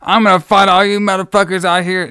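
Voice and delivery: put-on angry camp counselor ranting at the kids. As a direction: rant like a wild man spoiling for a fight. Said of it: "This guy was ranting".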